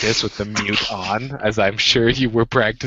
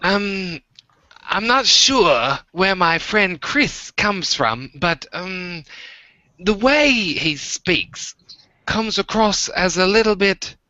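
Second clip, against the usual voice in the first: high, slow British-accented impression; drawn-out high voice, imitating a friend's accent; Chris; 8 semitones higher, slower than usual. Shown as a demonstration. Host shows Walter a UK accent by imitating a friend. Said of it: "I'm gonna pull my Chris out".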